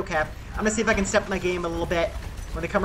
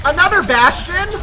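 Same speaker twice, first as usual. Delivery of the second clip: surprised; 9 semitones higher, 9 dB louder than usual. Real, not acted. Surprised at enemy Bastion.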